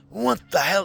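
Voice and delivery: high, loud mock exclamation of outrage; retold angry outburst. Retelling his fury at Grok last night: high loud outburst. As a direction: blurt it out in disbelief. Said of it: "I was furious".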